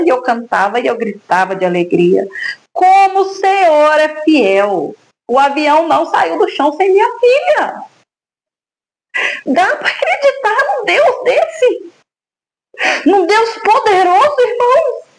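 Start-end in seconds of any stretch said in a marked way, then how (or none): 2.7-7.8 fervent praise. Real, not acted. Mother testifying to the church, fervent praise. 9.5-15.0 much higher, tearful, voice breaking; crying with emotion. Real, not acted. Mother testifying to the church, overcome and crying. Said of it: "eu me emociono".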